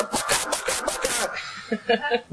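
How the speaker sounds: breathy high mock chicken squawk, slow. Comic squawking at a political rally dressed as a chicken. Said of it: "and I'd go".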